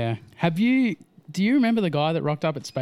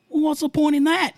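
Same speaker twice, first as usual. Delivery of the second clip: much higher, louder dopey questioner voice; mock clueless stranger; 14 semitones higher, 5 dB louder than usual. Mocking a stranger who doubts wingsuiting in a high, dopey voice. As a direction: play a baffled know-nothing.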